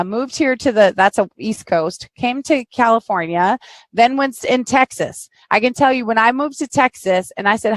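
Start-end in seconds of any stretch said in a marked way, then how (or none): none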